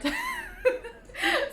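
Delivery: sudden high giggle; high-pitched giggle. Real, not acted. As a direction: burst into a squeaky giggle. Laughs at own indecision.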